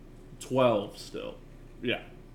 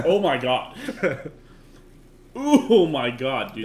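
laughing; 3 semitones higher, 5 dB louder, slower than usual. Real, not acted. Laughing at the sour taste.

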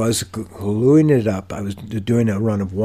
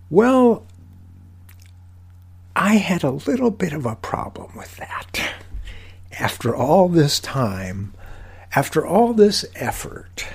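mock restraint; 8 semitones higher than usual. Mock-restrained telling of a meltdown.

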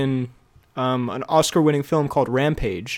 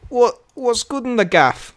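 slow, higher British geezer imitation; mock British accent; 6 semitones higher, slower than usual. Shown as a demonstration. Proving to a friend he's British, slow mock British accent. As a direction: draw it out in a put-on British accent. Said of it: "He's so British".

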